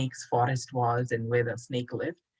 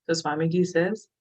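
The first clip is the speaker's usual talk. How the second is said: ordinary delivery, nothing marked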